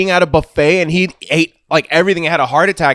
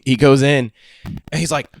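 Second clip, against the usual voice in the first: ordinary talk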